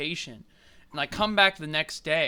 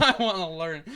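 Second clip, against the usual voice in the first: laughing repeat; 3 dB louder than usual. Retold or imitated. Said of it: "repeats the mocked quote".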